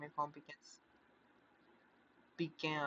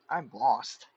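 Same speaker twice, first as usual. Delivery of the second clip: loud exasperation; 3 semitones lower, 13 dB louder than usual. Real, not acted. Confused by game plot.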